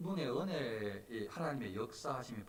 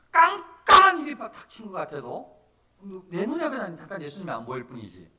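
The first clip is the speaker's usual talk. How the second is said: loud, raised sermon delivery; emphatic preaching; 6 semitones higher, 13 dB louder than usual. Real, not acted. Pastor assuring believers God never leaves, emphatic preaching. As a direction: drive the assurance home with force.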